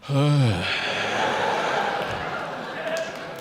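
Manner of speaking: long exhausted gasping exhale; out-of-breath sigh; heavy breathless sigh. Shown as a demonstration. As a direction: blow out air like you ate yourself out of breath. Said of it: "and you go".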